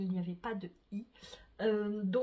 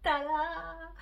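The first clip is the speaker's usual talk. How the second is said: high, bright tada; excited reveal; 7 semitones higher, 4 dB louder than usual. Real, not acted. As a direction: show it off with a flourish. Reveals finished sweater.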